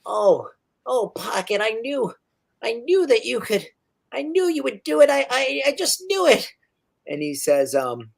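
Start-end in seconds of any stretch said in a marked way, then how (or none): 0.0-6.5 rising high gleeful gnome voice; higher and higher excitement; delighted gnome. Acted. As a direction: build up joy as he hugs the robot. Gnome overjoyed at Pocket.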